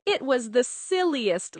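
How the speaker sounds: plain reading voice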